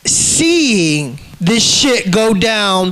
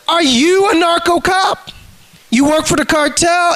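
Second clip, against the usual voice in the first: accusing challenge; 8 semitones higher than usual. Retold or imitated. Retelling his challenge to cop.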